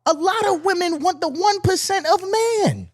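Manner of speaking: very high squeaky whine; mock whine. Complaining for tired men, high falsetto whine.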